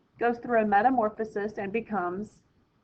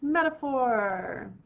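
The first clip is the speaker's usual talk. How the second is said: slow dramatic answer reveal; 3 semitones higher, much slower than usual. Shown as a demonstration.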